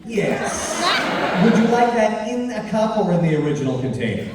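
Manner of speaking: loud, eager servile waiter; obsequious host voice. Acted. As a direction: fawn over the guests. Baloth serves the players.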